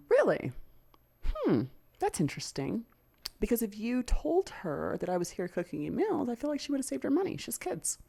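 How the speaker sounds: high-pitched, incredulous questioning; pointed disbelief. Acted. Girlfriend answering his burrito excuse, pointed disbelief. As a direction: react with loud, skeptical surprise, pitch staying up. Acting out her disbelieving reply to him.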